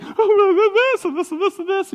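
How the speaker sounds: mock teen whining, high and laughing; whiny teenager. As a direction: play the teenager complaining, half laughing. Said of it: "they're like".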